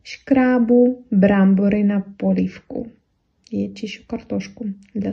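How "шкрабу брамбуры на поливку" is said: Czech phrase «Шкрабу брамбуры на поливку» said slowly and a little louder; slow example phrase. Shown as a demonstration. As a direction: model «Шкрабу брамбуры на поливку» carefully for students. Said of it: "можете сказать".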